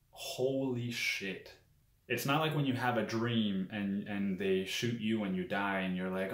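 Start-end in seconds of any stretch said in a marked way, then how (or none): 0.1-1.5 drawn-out, higher, slow exclamation; slow, stunned "holy shit"; retold stunned awe. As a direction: stretch it out slowly in mock awe. Stunned retold reaction to hallucination.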